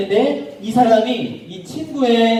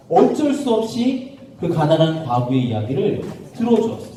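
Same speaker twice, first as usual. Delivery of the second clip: ordinary delivery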